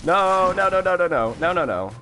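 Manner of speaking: high, loud string of no's; loud panic. Real, not acted. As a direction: yell no as the hit lands. Enemies jump at him.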